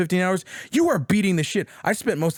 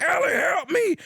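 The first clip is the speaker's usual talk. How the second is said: retold cry for help; 12 semitones higher than usual. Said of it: "as he's like".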